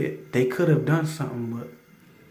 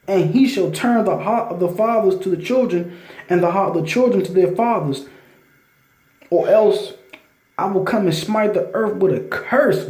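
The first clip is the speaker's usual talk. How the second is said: louder, slowed, preaching cadence; fervent scripture reading; 5 semitones higher, 4 dB louder than usual. Acted. Reading Malachi aloud to his listeners, fervent loud preaching. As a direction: proclaim the verses like a sermon.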